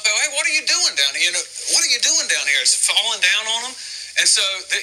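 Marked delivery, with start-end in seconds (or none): 0.0-2.6 mock call from below, high and quick; playful imitated yell from below. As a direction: shout up at the roof, half-played. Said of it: "they're probably yelling up".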